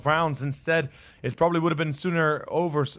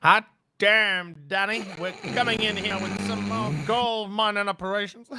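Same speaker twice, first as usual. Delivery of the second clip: badly faked cowboy voice, raised pitch; bad cowboy accent; 5 semitones higher than usual. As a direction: shout it out like a gold-rush prospector. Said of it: "I'm very bad at accent".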